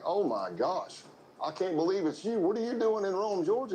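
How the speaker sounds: high, bright mock astonishment building up; staged surprise. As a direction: ham up bumping into an old friend. Staged surprise meeting old friend.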